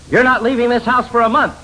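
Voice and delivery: high, loud scolding threat; angry mother. Retold or imitated. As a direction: mom losing her temper at the kids. Angry mother punishing child.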